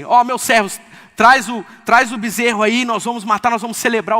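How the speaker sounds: father shouting to his servants to bring the calf, jubilant and loud; joyful father calling out. Acted. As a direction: call out the feast like an overjoyed father. Father orders servants to celebrate.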